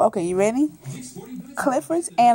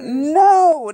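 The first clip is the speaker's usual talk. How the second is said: ordinary delivery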